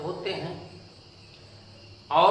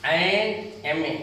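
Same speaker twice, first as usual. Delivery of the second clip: slow demo; much slower than usual. Shown as a demonstration. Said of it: "लेकिन इसका उच्चारण".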